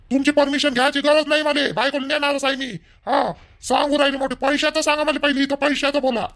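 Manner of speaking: angry shouting. Acted. Son-in-law demanding money.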